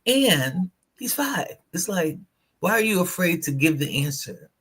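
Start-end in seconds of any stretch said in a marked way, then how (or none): none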